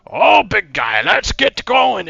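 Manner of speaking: high, loud, slow goading call; goblin handler. Acted. Goblin in armor drives the ogre forward, high loud goading call. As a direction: urge the ogre on with a crack of the whip. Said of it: "and he goes".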